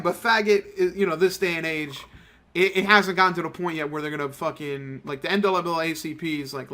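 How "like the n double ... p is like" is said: ordinary delivery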